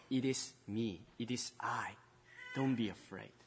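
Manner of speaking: soft, gentle reassurance; comforting. Acted. Jesus calming his scared disciples, gently. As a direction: speak softly to calm frightened friends. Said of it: "he wanted to comfort them".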